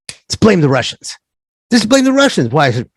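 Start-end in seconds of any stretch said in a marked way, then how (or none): 0.1-1.2 first take, sly plotting tone; mock bankers' scheme. Imagined banker pitching a scapegoat, sly plotting. 1.7-2.5 much higher, insistent repeat of the idea; mock bankers' scheme, pushed higher. Imagined banker insisting on blaming Russians, high and mocking.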